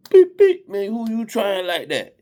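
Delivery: very high-pitched bleeped-out outburst; hammed-up insubordinate protest; mock emotional backtalk. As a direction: act out a hot-headed retort, voice way up. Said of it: "instead of emotionally responding back".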